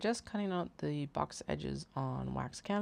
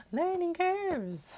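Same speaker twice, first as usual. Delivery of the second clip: loud, high and stretched out after a laugh; high drawn-out exclamation; 8 dB louder, much slower than usual. Real, not acted. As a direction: sing it out high, laughing off your mistake. Laughs off own mistake.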